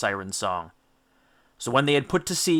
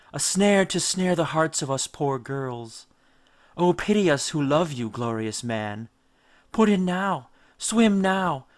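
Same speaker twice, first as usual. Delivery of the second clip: higher, slow eerie coaxing voice; luring sirens; 4 semitones higher than usual. Acted. Sirens beckon the captain to jump in, slow and coaxing. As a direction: coax him into the water, sweet and strange.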